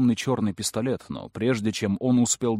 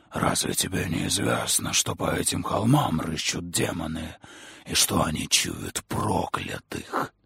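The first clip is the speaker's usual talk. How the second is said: eerie whisper; 4 semitones lower than usual. Acted. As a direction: whisper it like something inhuman. Creature voice, whispered.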